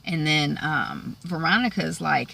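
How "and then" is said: ordinary talk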